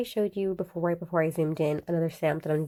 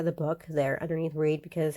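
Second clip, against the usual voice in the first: usual speaking voice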